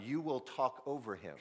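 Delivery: ordinary talk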